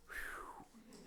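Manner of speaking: soft, voiceless whew; children's relieved exhale, imitated; imitated sigh of relief. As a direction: let out a long, airy breath of relief. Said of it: "all the four children just went".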